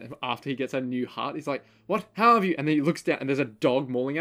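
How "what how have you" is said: high, loud disbelieving cry; shocked cry. Retold or imitated. Retelling a cartoon to a friend, loud stunned cry. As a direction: blurt it out stunned.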